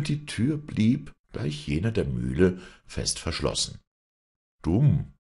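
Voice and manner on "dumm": grumbling. Acted. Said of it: "brummte Gottlieb".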